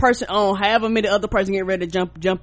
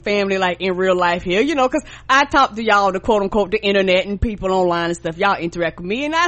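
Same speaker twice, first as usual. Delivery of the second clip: ordinary talk, nothing marked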